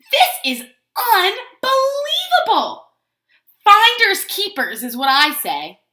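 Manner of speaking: loud, slow huffy claim; indignant Pigeon. Acted. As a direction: stake your claim in outrage.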